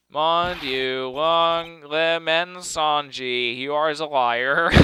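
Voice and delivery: mock French accent, very drawn-out middle. The cook calling the lawyer a liar in a mock French accent. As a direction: accuse him of lying in a lightly put-on French accent. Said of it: "the Frenchman's".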